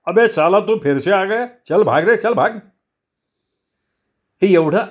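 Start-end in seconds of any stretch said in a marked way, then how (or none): 0.1-2.5 high-pitched, irritated shop owner snapping; angry snapping yell. Acted. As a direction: shoo him off in a raised, angry pitch. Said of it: "शेठ एकदम खेकसून ओरडला". (खेकसून, चढ्या आवाजात)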